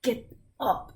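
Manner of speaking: irate guard. Acted. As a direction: bark it at the prisoner.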